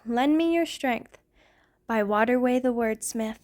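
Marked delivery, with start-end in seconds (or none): none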